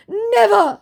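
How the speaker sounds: high, loud emphatic cry; mock declaration. Impostor swearing he won't pretend, mock-dramatic declaration. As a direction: declare it with exclamation points. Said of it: "he declares, with exclamation points".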